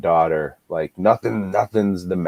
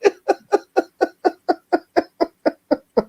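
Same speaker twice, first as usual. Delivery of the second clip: high burst of laughter; real laugh. Real, not acted. Remembering the lamb scene, laughing high.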